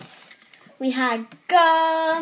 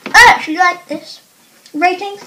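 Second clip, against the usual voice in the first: talking with mouth chewing; chewy tasting mumble; chewing gum while talking; 8 dB louder than usual. Real, not acted. Seen 3: Speaker's close-up mouth moves as chewing; no gum itself visible.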